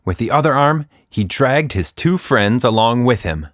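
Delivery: usual speaking voice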